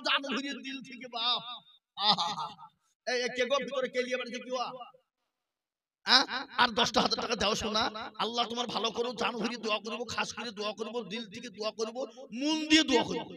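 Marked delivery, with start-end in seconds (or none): none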